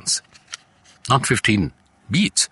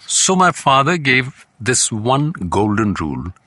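ordinary talk, nothing marked

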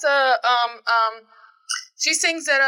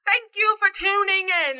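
higher, slow British sign-off; British accent; 6 semitones higher than usual. Pretend. Co-host thanking listeners, slow hammed-up British accent.

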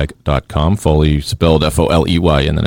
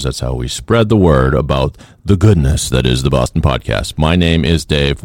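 normal talking voice

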